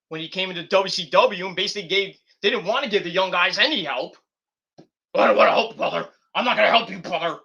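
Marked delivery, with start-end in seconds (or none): 5.1-7.4 whispered then raspy refusal, brother; Hulk Hogan impression. Mocking a wrestler who refuses to help young guys, put-on voice. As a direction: growl out the refusal like the old wrestler. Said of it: "like Hogan".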